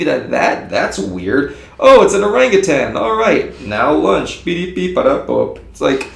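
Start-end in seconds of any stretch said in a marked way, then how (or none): 1.8-4.4 high, loud mock-breezy voice brushing it off; mocking dismissive. Mocking a wildlife officer who brushes off the sighting, breezy and dismissive. 4.4-5.6 silly beeping noise. Retold or imitated. Imitating a careless official.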